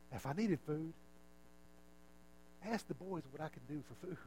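a gentle teacher correcting a friend kindly; Jesus gently telling Martha he came for her, softly. Retold or imitated. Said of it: "I could just imagine Jesus".

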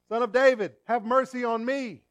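loud, high desperate cry; calling out for mercy. Retold or imitated. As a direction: cry out to be heard from the roadside. Blind man crying out to Jesus.

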